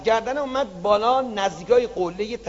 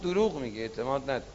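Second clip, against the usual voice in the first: quiet rebuke; 7 semitones lower, 9 dB quieter, much faster than usual. Acted. God calm rebuke.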